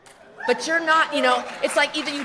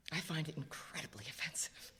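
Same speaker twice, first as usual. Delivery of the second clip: whisper; 7 semitones lower, 17 dB quieter than usual. Real, not acted. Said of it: "whispered, unvoiced".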